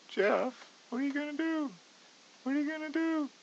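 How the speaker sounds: squeaky high mock panic at himself. Trader mocking his own panic over the trade, high squeal. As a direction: ask yourself in a cartoon shriek, pleading.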